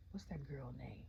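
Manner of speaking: soft whisper to herself while trying to recall a name; under-the-breath question to self; whispered aside. Real, not acted. Muttering to herself, searching for a name.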